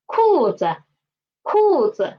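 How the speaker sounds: 裤子 with falling 裤, low neutral 子. Shown as a demonstration. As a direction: drop sharply on 裤, land low on 子. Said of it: "Read after me".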